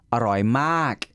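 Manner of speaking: aroy mak, rolled R, falling on mak; Thai aroy mak. Shown as a demonstration. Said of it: "If you wanna say, like, super good".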